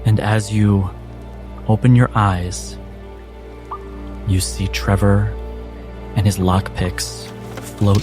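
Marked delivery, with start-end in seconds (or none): none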